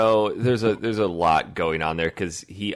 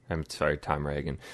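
ordinary talk, nothing marked

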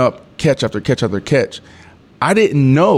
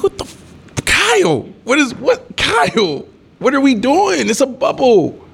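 much higher, pleading rant at the quarterback; frantic frustration; 14 semitones higher, slower than usual. Retold or imitated. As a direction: freak out at Kyle over the missed pass. Yelling at quarterback after bad throw.

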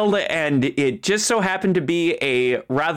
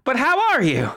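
Marjorie voice; 8 semitones higher than usual. Acted. Marjorie asks again.

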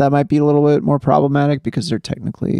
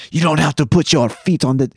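lightly imitated French accent. Retelling a French man scolding Emily, lightly imitated French accent. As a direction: put on a French accent for the complaint. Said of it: "He's got a thick French accent".

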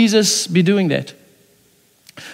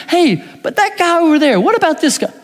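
higher, sudden distracted voice; distracted aside; 7 semitones higher than usual. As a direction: break off to point at someone else. Peter distracted, pointing at John.

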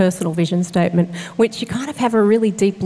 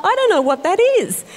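bewildered; 12 semitones higher than usual. Retold or imitated. Retold bewildered reply to group.

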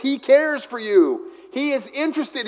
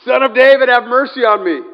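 full loud, high shout; crying out louder; 4 semitones higher, 9 dB louder than usual. Acted. Blind beggar refusing to be hushed, crying out louder. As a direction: shout over a crowd for mercy. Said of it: "he kept crying out all the more".

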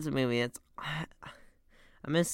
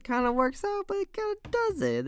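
high mocking voice.

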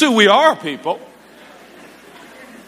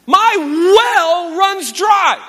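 mock lament; 8 semitones higher, much slower than usual. Comic lament over failing to do well.

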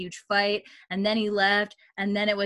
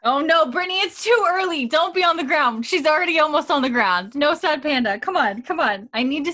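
high, loud coaxing; playful pleading; 6 semitones higher, 6 dB louder than usual. Real, not acted. Host to slumping co-host before next scene, high playful pleading. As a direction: plead with a friend in mock alarm.

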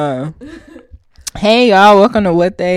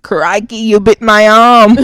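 mock Aussie wildlife host, louder on the bite; playful Australian accent, louder at the end; Australian impression; 3 semitones higher, 9 dB louder than usual.

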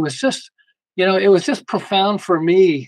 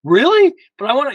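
whiny protest; 9 semitones higher, 8 dB louder than usual. Retold or imitated. Imitating a guy eager to interrupt.

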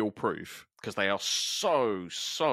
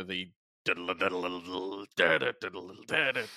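lightly voiced imitation of a guitar riff. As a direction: mouth the guitar riff as fast syllables. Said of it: "that bit".

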